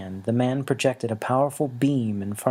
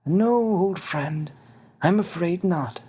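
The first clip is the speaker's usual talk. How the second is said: put-on higher, slow regretful old voice; little winged man, sorrowful; 7 semitones higher, slower than usual. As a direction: break bad news gently to a friend. Xavier's voice.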